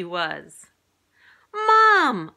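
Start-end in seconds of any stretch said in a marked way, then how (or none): 1.5-2.3 high, loud call for mom; excited yell. A narrator's reading. Boy spotting a triceratops, yelling to mom. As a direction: yell out for your mother. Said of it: "I yelled".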